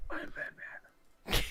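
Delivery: slow whispered Batman line. Retold or imitated. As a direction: breathe it like a brooding vigilante. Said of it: "He's like".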